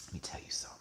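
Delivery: whispered, as if too overcome to speak; very low, hushed aside; breathless whisper. Real, not acted. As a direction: whisper it under your breath. Swooning, repeats line under breath.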